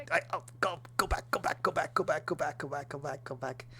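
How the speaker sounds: high, urgent pleading at the video; urgent go back. Real, not acted. Scrubbing video looking for himself.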